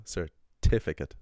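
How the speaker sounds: "certificate" with the second syllable punched, slow and loud; stress on TI. Shown as a demonstration. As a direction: hit the "ti" in "certificate" hard. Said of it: "The stress is on the t".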